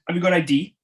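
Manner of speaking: loud, high mock-stern challenge; stern shopkeeper. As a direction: bark it like a shopkeeper catching you out. Shopkeeper Ray retold, demanding ID.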